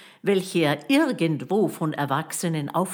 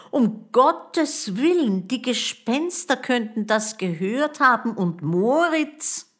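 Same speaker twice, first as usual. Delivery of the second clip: high, loud, dawning alarm; 8 semitones higher, 4 dB louder than usual. A narrator's reading. Boy fears the ghosts overheard his friend, alarmed. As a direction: blurt it out in alarm, pitched up.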